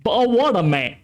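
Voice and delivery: a light mock British Bottle of water, with the t dropped; a touch of a put-on London accent. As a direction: play at being British, lightly. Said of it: "you're just British?".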